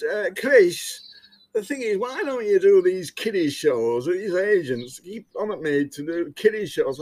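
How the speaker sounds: lightly put-on Lancashire accent; A J's Lancashire accent. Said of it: "I'm going to have to do A J's Lancashire accent".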